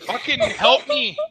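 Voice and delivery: slow, high plea through laughter; helpless laughter. Real, not acted. Streamer stuck in Canadian bit begs friends, laughing helplessly. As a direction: beg for help while cracking up. Helpless giggles, begging for help.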